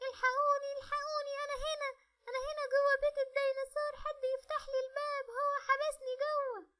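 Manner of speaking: put-on high, loud little girl's cry for help; a child locked inside shouting to be rescued; trapped little girl. (بنت صغيرة محبوسة بتنادي بصوت عالي عشان حد ينقذها)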